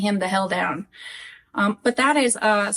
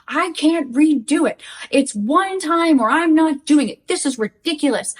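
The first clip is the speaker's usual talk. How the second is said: imitating exasperated refusal, higher pitch; mock indignant outburst, emphatic and louder; mad retelling; 6 semitones higher, 4 dB louder than usual. Husband tells wife he cannot refilm the video, mad. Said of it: "He would be mad at me".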